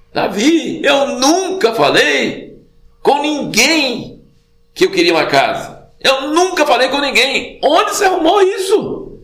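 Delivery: high-pitched, drawn-out indignant protest; mock scolding. God answering David about the house, mock scolding. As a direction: act out a climbing, incredulous telling-off. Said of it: "parece que ele tá dando a bronca".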